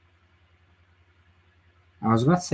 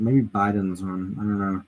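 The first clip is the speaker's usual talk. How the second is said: normal talking voice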